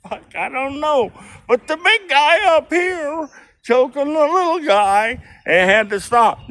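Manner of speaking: very high, excited protest; retold protest. Excusing himself to Linda, in a very high excited voice. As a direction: squeak out his excuse to Linda. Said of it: "And I went".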